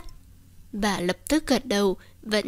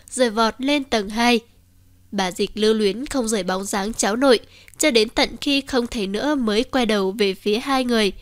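plain reading voice

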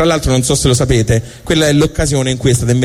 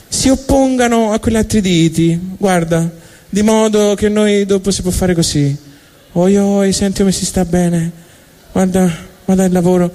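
higher, showing off thumbs with delight; smug human; 7 semitones higher than usual. Acted. Humans showing God their thumbs, delighted higher voice. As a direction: savour the thumb trick like a new toy.

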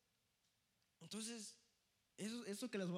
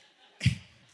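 loud laugh; 21 dB louder than usual. Real, not acted. Laughing mid-story about Greg.

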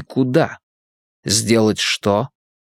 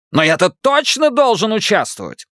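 higher, indignant Irish brogue; insistent Irish accent; 10 semitones higher than usual. Acted. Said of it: "Ирландский акцент работал на всю катушку".

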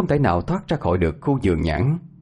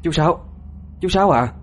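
calling out; 9 semitones higher than usual. Acted. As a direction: shout names into the night. Calling out in the orchard.

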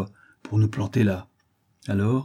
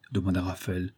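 plain reading voice

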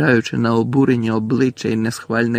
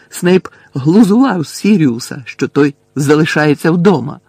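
loud, higher-pitched outburst; indignant and forceful; heated accusation; 5 semitones higher, 8 dB louder than usual. A narrator's reading. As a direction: accuse loudly, voice raised. Harry's heated accusation in the quarrel.

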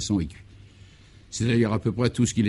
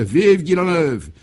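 loud, higher, slow cheering cries; crowd cheering; 6 semitones higher, 5 dB louder, slower than usual. Acted. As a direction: chant it like a delirious crowd in triumph. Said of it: "aux cris mille fois répétés".

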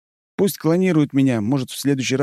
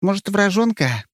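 bloodthirsty dreamy; 7 semitones higher than usual. A narrator's reading. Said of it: "кровожадно-мечтательно замечает Ярко".

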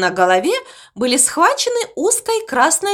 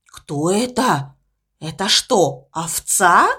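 lower, slow and puzzled; low pig voice, wondering; 6 semitones lower, slower than usual. Acted. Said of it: "озадаченно спросил он". (озадаченно, низким голосом)

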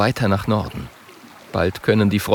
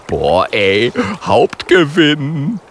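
loud, drawn-out jackpot cry; wowed, stretching the words; gleeful excitement; 5 semitones higher, 4 dB louder, much slower than usual. A narrator's reading. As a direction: burst out, relishing the prize. Bear thrilled by prey.

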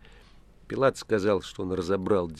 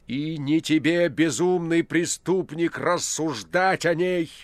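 slow, much higher and louder furious scolding; angry rebuke; 8 semitones higher, 7 dB louder, slower than usual. A narrator's reading. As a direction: spit the words down at the prisoner. Pilate rebukes the prisoner.

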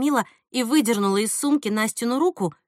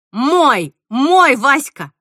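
loud, drawn-out jealous claim; possessive outburst; 3 semitones higher, 8 dB louder, much slower than usual. A narrator's reading. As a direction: cry out your claim to him. Jealous woman claims her man. (громко, ревниво, с нажимом)

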